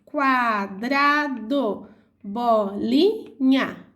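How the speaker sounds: Quadrado and bolinha said very slowly, split into syllables; teacher sounding out each syllable; syllables spelled out. Shown as a demonstration. Teacher to pupils, sounding out syllables slowly. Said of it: "as palavras que têm três sílabas".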